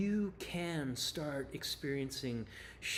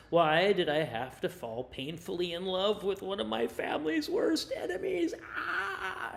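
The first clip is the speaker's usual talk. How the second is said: anguished Juliet; 7 semitones higher, 6 dB louder than usual. Acted. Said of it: "In other words".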